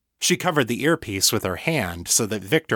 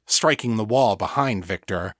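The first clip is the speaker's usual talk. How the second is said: plain reading voice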